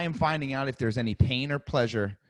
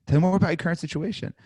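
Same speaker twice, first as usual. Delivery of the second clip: higher, fast, inviting; interested agent tonality; 5 semitones higher, 4 dB louder, faster than usual. Shown as a demonstration. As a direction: invite them to keep talking. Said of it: "Hear my tonality".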